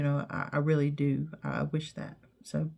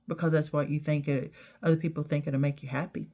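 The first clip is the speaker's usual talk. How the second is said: ordinary talk, nothing marked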